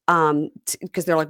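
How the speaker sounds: ordinary talk, nothing marked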